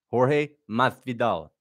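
Masvidal with a lisped th, slow Spanish-style. Retold or imitated. Streamer mimicking a commentator's lisped Spanish name, slow. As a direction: imitate a Spaniard saying Masvidal slowly. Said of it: "He says it with a lisp".

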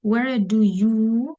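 slow, stretched YOU left hanging; fill-in-the-blank teacher prompt; drawn-out prompt. Shown as a demonstration. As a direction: stretch "you" and leave the question open. Pauses for students to supply verb.

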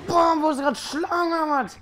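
high, loud startled yell; startled shout. Real, not acted. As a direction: jump in fright and yell. Startled by a snake in game. (erschrockener Aufschrei)

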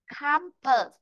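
"Campus" with CAM high, falling to a weak "pus"; stress on first syllable. Shown as a demonstration. Teacher explains the vowels, first syllable stressed. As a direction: punch "Cam" in "Campus", schwa after. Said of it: "Chúng ta sẽ đọc là khảm".

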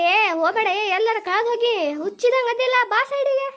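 ordinary delivery

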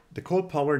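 high, loud sudden cry; startled high call; alarmed exclamation. Real, not acted. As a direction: yelp as the wrong thing happens. Misclick exclamation.

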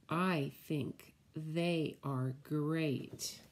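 slow, sing-song picture book read; reading to children. Acted. Teacher reading the spider book to children, slow and sing-song. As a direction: savour each spider word for little ones.

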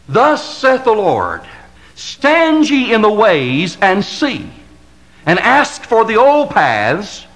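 slow solemn Bible reading; solemn scripture reading. Acted. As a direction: intone the verse from the pulpit. Reads scripture in solemn cadence.

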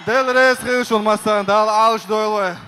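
loud shout in Chechen. Real, not acted. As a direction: shout it to the home crowd. Fighter shouts to the crowd.

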